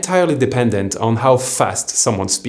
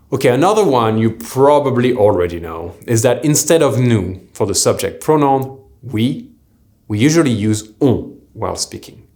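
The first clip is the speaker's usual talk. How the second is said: normal talking voice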